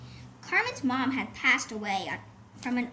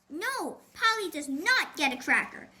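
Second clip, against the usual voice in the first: ordinary delivery, nothing marked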